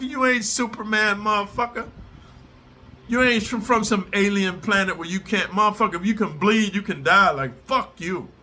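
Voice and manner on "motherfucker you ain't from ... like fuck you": usual speaking voice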